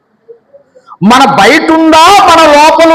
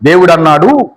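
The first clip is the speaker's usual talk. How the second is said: ordinary delivery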